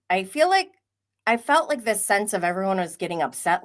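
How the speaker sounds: ordinary talk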